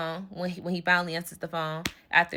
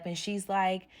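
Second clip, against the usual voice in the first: ordinary talk, nothing marked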